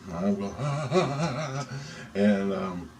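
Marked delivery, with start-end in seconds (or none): 0.6-1.7 high, juddering "ah-ah-ah"; pulsing, quavering high wail; head-pounding cry. Retold or imitated. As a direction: cry out as if jolted against a wall. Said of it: "my head's pounding off the wall".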